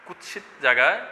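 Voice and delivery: usual speaking voice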